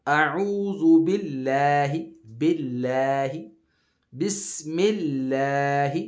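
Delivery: اللہ in باللہ with thin, light لام, slow; thin lam. Shown as a demonstration. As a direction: keep the لام thin after a zer. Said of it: "اس کو باریک پڑھتے ہیں،".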